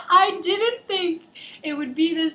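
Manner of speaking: repeated while crying with laughter; laughing retelling; laughing through it. Retold or imitated. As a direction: barely get the words out for laughing.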